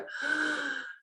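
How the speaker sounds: sharp, dismayed intake of breath; a theatrical gasp of dread; dramatic gasp. Retold or imitated. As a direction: gasp as if hit with a chore. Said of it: "And I go".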